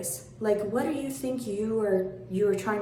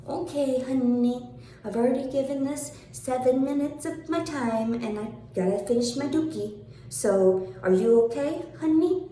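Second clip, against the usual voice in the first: sarcastic brush-off; 5 semitones higher than usual. Acted. Done with the critic, a high sugary mock. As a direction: sweetly dismiss her with fake concern.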